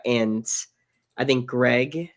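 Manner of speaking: normal talking voice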